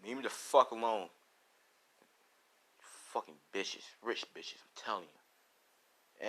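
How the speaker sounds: low, hushed muttered insult; seething. Acted. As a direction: seethe under your breath. Deku seething at rich classmates.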